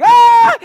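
startled parent's shriek; alarmed shout. Retold or imitated. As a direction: yelp on seeing the cream in the baby's mouth. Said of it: "and I'm like".

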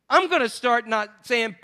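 ordinary delivery

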